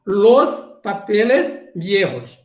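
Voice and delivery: "Los papeles viejos" said slowly in careful Spanish; Spanish pronunciation. Shown as a demonstration. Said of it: "Atenção". ("Los papeles viejos" dito devagar em espanhol)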